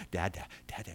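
soft, low repeated prompt; hushed parent repeating a baby word; coaxing parent. Acted. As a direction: patiently coach a baby, very quietly. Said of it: "you say it over and over".

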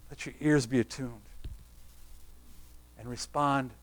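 very soft, low preaching with long pauses; low, subdued, solemn closing appeal; hushed altar appeal. Acted. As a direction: quietly invite the congregation to respond, leaving space between phrases.

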